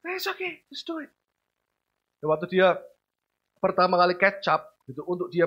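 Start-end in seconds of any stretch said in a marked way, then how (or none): 0.1-1.1 high, very soft easygoing comfort; calm the child before the contest; relaxed reassurance. Retold or imitated. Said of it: "Kita nyantai aja". (santai menenangkan anak, pelan)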